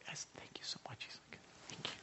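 quick unvoiced whisper of thanks; nervous whisper. Nervous worker thanking the patient later, whispering. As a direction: keep it hushed and a little nervous.

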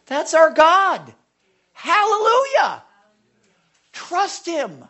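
high, loud, slow proclamation; exultant praise. Real, not acted. The preacher praising God before the congregation, high and loud. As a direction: shout it to the rafters.

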